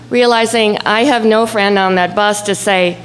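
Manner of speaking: ordinary delivery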